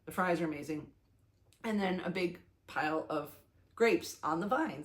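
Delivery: usual speaking voice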